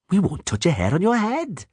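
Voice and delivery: sugary menacing dwarf; sweet with a threat; mock sweet. A narrator's reading. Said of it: "Zoltan added sweetly".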